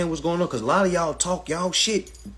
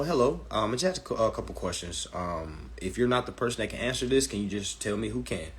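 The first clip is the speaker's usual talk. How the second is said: polite phone call; 4 semitones lower, 7 dB quieter than usual. Shown as a demonstration. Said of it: "call your management".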